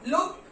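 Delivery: लुक said short, without the ending; the wrong way, लुक; wrong reading. Shown as a demonstration. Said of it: "ऐसा नहीं बोलते". (गलत उच्चारण, लुक)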